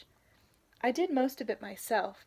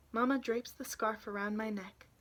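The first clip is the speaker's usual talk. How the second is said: usual speaking voice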